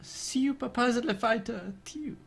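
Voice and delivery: high-pitched announcer voice. Shown as a demonstration. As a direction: squeak the title like an arcade announcer. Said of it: "you have to be much more high-pitched".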